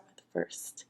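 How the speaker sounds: soft whispered aside; whispering. Real, not acted. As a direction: hush your voice to a whisper. Whispering to the camera.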